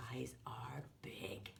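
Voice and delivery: hushed, spooky pretend-scared whisper for little kids; mock scared whisper. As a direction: whisper it like a playful fright. Showing scared face to kids.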